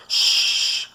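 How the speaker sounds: sharp unvoiced shush; doctor shushing a woman in the dark woods. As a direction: hush her urgently. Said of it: "Doctor Cruz shushed her".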